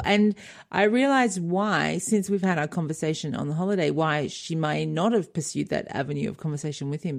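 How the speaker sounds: ordinary talk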